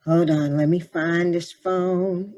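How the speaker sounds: ordinary talk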